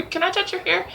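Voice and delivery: high, mock eager stranger asking to touch hair. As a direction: squeak it like a nosy stranger, then turn on them. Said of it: "or be".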